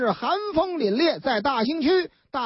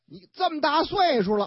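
ordinary delivery

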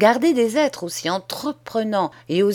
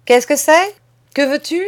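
higher, louder impatient demand; brusque master; 8 semitones higher, 7 dB louder than usual. Acted. Schoolmaster challenging the boy, loud and impatient. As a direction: bark a curt question at the boy.

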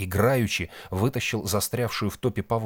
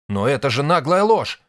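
high, loud indignant protest; incredulous outrage; 7 semitones higher, 8 dB louder than usual. A narrator's reading. Nik hearing the false accusation, outraged disbelief. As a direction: burst out against a brazen lie. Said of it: "Ник не верил своим ушам".

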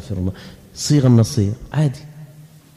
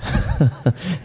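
short laugh; 6 dB quieter than usual. Real, not acted. Speaker laughs at joke.

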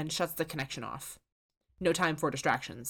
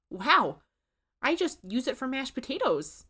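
higher, louder startled wow; surprised Susan; 8 semitones higher, 3 dB louder than usual. A narrator's reading. Susan sees the chef rise from her pot, startled. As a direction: gape at the ghost.

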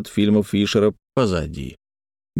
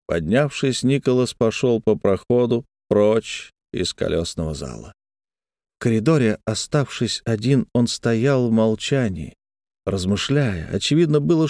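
ordinary delivery, nothing marked

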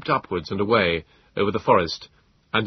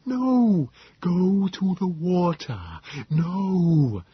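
high, slow creature voice; small liquid voice; 10 semitones higher, much slower than usual. A narrator's reading. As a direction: draw the words out, high and liquid. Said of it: "a small, liquid voice came floating".

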